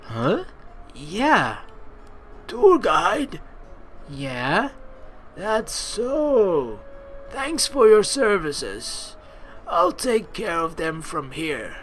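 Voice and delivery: put-on slow, gentle old man; kindly grandfather dismissing the guide; grandpa voice. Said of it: "roll with the grandpa voice".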